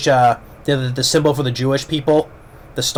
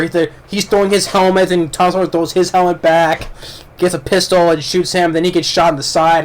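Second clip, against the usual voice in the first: ordinary delivery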